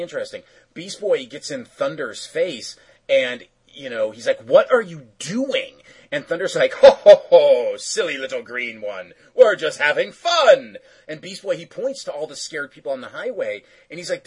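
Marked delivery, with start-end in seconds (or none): 4.4-5.8 higher, drawn-out demand; mock exasperation. Beast Boy confronts Thunder in retelling. 6.7-10.8 slow, booming mock laugh into teasing line; jolly cartoon villain. Voices Thunder brushing off Beast Boy.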